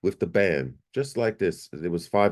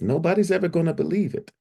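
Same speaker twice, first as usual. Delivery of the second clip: much higher, quicker outburst; incredulous lament; 10 semitones higher, faster than usual. Retold or imitated. Man telling himself after the visit, disbelieving. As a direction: let the voice climb in disbelief. Despairing self-talk after tears burst out.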